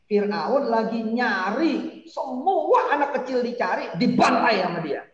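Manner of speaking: excited storytelling. Acted. Animated storytelling, raised voice.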